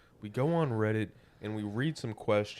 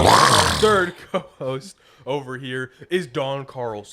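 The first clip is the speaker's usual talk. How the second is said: announcer voice; 10 dB louder, slower than usual. Acted. Announcing co-host name.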